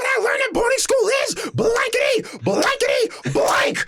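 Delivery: very high, shrill-pitched crying shout; imitating Missus Puff. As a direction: wail it way up high like a panicked teacher. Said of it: "she's like".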